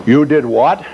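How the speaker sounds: retold booming disbelief; booming priest. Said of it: "you could hear him all over the church".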